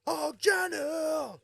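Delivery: drawn-out high shout of Johnny; mock shout. Streamer imitating a friend's slow high Oh Johnny shout. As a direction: shout the name slowly and high.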